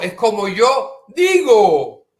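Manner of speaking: slow loud insistence; insisting. Retold or imitated. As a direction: hammer the point home. Repeated insistence, building. (insistencia lenta y fuerte)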